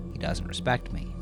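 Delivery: plain reading voice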